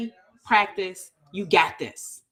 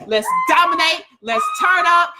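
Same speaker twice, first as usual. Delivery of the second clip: excited, higher-pitched send-off; rallying hype; 7 semitones higher, 5 dB louder than usual. Real, not acted. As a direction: fire up the group before signing off. Hyping up the group at sign-off.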